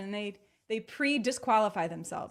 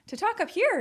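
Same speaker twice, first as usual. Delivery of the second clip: raised, higher pitch to show it; talking really high; 11 semitones higher, 4 dB louder than usual. Shown as a demonstration. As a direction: lift the voice up high. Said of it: "talk really high".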